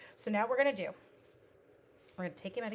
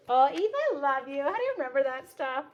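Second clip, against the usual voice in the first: delighted; 5 semitones higher, 10 dB louder than usual. Real, not acted. Delighted at a viewer.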